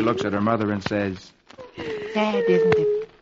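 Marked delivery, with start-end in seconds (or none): 2.1-3.1 put-on very high small-child voice; little girl's voice. Cookie looks at her crying mother, high little-girl voice.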